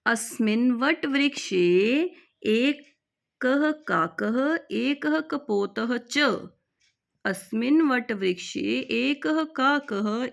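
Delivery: slow, careful reading of the Sanskrit line; slow recitation. Shown as a demonstration. Teacher reading the lesson sentence slowly. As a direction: recite slowly so students can follow. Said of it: "reading Sanskrit text slowly".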